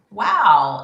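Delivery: loud burst of delight; excited wow. Retold or imitated. Maker reacting to a new order, loud delighted gasp. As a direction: blurt it out thrilled.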